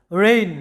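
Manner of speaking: রেইন said like "rain", g silent; রেইন with no g sound; English word reign. Shown as a demonstration. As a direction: model রেইন, one syllable. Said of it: "এরপরে দেখো".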